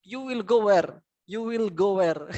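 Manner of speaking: high-pitched mock learner question; imitated newcomer English. Newcomer shouts wrong English to cyclist, imitated newcomer. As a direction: lightly mimic a confident beginner, pitched high. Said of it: "bahasa Inggris yang lucu".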